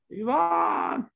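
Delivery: high, ringing call across the apartment; retold holler. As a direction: call out for Yvonne from the bathroom. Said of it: "And I hollered for Yvonne".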